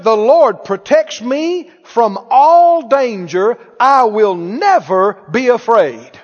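loud, slow, emphatic Bible reading; drawn-out preacher's proclamation; proclaimed scripture. Real, not acted. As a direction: declare each phrase boldly to the congregation.

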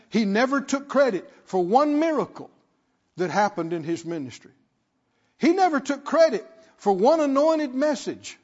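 rhythmic preaching that builds with repetition; emphatic preaching. Real, not acted. Preacher insists Jesus never took credit, building emphatic preaching. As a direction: drive the repeated point home harder. Preacher hammers a repeated point.

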